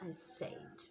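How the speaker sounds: breathy whispered repeat; whispered aside. Real, not acted. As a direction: drop to a whisper, amused. Laughing whisper repeat of line.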